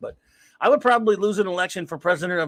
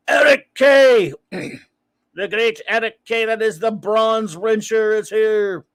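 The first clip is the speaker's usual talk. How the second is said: slow, loud and higher, a ringside announcer calling a name; mock announcer intro; 4 semitones higher, 7 dB louder, much slower than usual. Streamer hypes a viewer's arrival like an announcer, slow and loud. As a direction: stretch it out like introducing a champion. Hyping a chat member's arrival.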